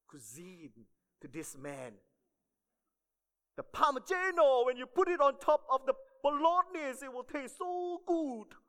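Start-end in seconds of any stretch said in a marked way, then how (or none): 3.6-8.5 put-on high, loud, botched Italian waiter accent; badly faked Italian accent, pitched way up; wrong Italian accent. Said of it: "Sorry, wrong accent".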